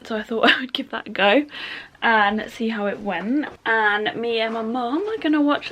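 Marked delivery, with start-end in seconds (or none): none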